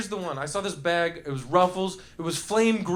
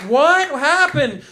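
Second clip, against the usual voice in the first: drawn-out, higher and louder cry of confusion; mock bewilderment; 7 semitones higher, 7 dB louder, much slower than usual. Eater waking from a chip coma, mock bewildered. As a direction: play someone waking up baffled.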